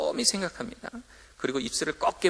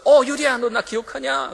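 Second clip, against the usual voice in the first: delighted greeting; 9 semitones higher, 6 dB louder than usual. Retold or imitated. Greeting girl in cafe, delighted.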